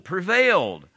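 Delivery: PREVAILED thrown out high and loud; "prevailed" proclaimed with a high, falling stress; emphatic stress. Shown as a demonstration. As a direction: punch "prevailed" as the word that comes first. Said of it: "that has to have the emphasis".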